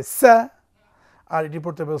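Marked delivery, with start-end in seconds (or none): 0.0-0.5 সা with a sharp hissing s; whistled sa. Shown as a demonstration. Teacher models the hissing letter for the class. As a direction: hiss the s in সা. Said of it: "শীষ দিয়ে পড়তে হবে".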